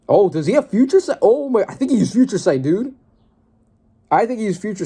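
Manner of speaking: loud, high thrilled gasp of discovery; can't believe the twist, loud and high; excited realization. Real, not acted. Working out Future Sight, loud and thrilled.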